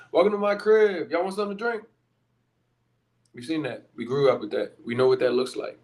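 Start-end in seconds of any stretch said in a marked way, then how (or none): 0.1-1.8 higher, bragging MTV Cribs host voice; playful imitation of a showy crib tour; mock show-off host. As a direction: ham up a flashy host showing off. Mocking Instagram show-off host.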